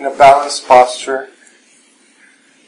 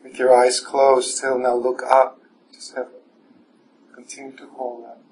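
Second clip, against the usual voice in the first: guided meditation; 7 dB quieter than usual. Acted. Teacher guiding the evening class through standing meditation, soft and calm. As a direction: guide the room gently inward, unhurried.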